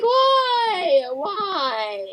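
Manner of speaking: high, drawn-out whiny wail; hammed-up tearful "why"; mock despair. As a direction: moan it in comic agony. Retold embarrassment over dog's name.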